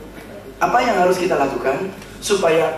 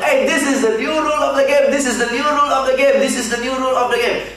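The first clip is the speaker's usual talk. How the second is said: shouted slogan; 7 semitones higher than usual. Retold or imitated. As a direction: shout it to the world. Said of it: "Shout like hell".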